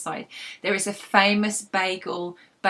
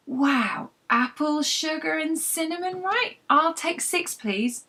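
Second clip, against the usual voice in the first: Rosie guessing eagerly; 8 semitones higher, slower than usual. Acted.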